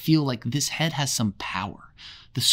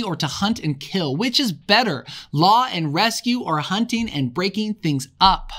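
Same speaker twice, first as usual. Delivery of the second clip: preaching; 6 semitones higher than usual. Acted. Blind Piggy pleads with the tribe, impassioned preaching. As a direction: hammer each "Which is better" like a preacher. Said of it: "As he preaches".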